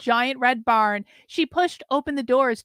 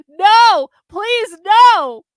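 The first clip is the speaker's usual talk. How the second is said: high, loud, panicked pleading; terrified begging; 7 semitones higher, 11 dB louder, much slower than usual. Acted. James begs Carlin not to whip him, terrified screaming. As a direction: beg for mercy at full volume.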